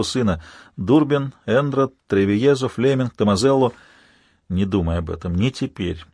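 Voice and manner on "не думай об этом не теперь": quiet, low inner thought. A narrator's reading. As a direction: murmur to yourself, low and soft. Hero's inner self-talk.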